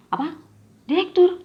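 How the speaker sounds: shocked to learn he's the director. A narrator's reading. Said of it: "Aku kaget bukan main".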